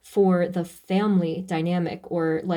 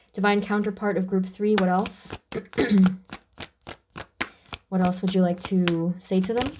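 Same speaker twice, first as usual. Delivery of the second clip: strained, effortful voice. Real, not acted. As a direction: speak through a strained throat. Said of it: "my voice is strained".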